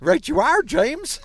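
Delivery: high, loud, hammed-up pitchman voice; Mister Cheap voice. Guest greets the host in his mascot voice, high and loud. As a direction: ham up your mascot character's greeting. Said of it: "That's Mister Cheap talked".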